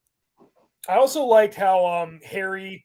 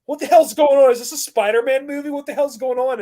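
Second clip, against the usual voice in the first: incredulous outburst; 5 semitones higher, 6 dB louder than usual. Real, not acted. Outraged at the dance scene.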